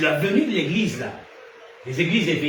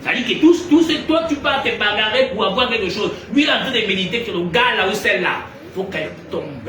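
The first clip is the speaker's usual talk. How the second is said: loud, high preacher's build; rising to a fiery pitch, pounding the point; preaching at full force; 6 semitones higher, 6 dB louder than usual. Acted. Preacher rousing the congregation, fiery loud preaching.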